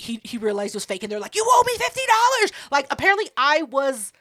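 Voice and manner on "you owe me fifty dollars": high, loud imitation of outrage; mock angry demand. Sisters to the one who lost the ID, mock-angry yelling. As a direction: imitate someone demanding money, pitched way up.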